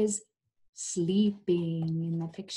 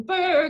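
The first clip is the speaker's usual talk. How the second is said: shivering brr; 10 semitones higher, 6 dB louder than usual. A narrator's reading. Shivering dog.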